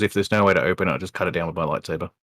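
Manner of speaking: usual speaking voice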